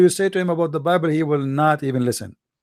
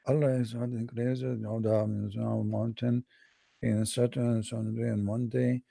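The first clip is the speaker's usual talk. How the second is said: much softer, lower; quiet reading; 6 semitones lower, 12 dB quieter than usual. Retold or imitated. The caller reading the hadith, low and soft. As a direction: mumble through the hadith.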